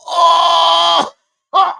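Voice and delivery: very loud, high whooping "oh" laugh; overdone woman's laugh. Retold or imitated. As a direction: imitate an overblown laugh at full volume. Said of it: "she laughed. She would go".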